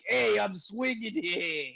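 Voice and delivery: slow, high New York drawl; Queens accent. Pretend. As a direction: stretch it out like a Queens kid. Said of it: "why isn't Spider-Man like".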